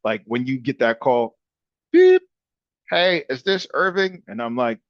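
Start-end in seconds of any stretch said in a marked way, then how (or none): 1.9-2.2 high, loud beep; imitated beep. Imitating a robocall's opening tone. 2.9-4.2 higher-pitched canned caller greeting; robocall voice. Retold or imitated. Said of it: "how it is with those robocalls".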